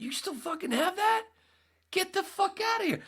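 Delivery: very high, loud comic shriek; shocked disbelief. Retold or imitated. Reacting to the kids' news of the sign, shrieked disbelief. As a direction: squeal it out, utterly incredulous. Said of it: "hit me, and I went".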